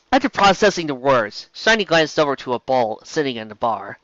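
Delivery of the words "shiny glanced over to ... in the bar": ordinary delivery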